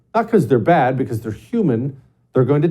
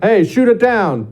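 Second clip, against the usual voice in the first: higher, louder, slow commanding voice; president's order; 10 semitones higher, 4 dB louder than usual. Retold or imitated. The president ordering the military to fire, slow loud command. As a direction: bark it down the chain of command.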